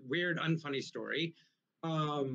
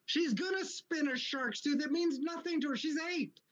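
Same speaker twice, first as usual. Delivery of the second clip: indignant retold rant; 10 semitones higher than usual. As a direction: re-enact an argument in a strained high pitch. Retold quarrel with the ex-wife.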